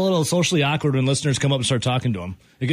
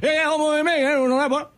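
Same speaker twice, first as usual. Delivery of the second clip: gummy mumble, pitched up; toothless voice; 10 semitones higher, faster than usual. Pretend. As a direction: talk like you lost your teeth. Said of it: "You're talking like you have no teeth".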